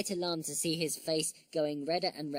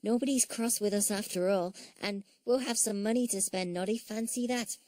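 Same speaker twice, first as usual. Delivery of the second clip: put-on high, pleased little-girl character; small, delighted storybook voice; Tessie; 5 semitones higher than usual. A narrator's reading. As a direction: marvel happily in a high voice.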